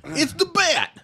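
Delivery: very high goon alarm; henchman's yell. Acted. Game henchman spotting Batman, high mock yell. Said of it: "henchman in an Arkham game who yells".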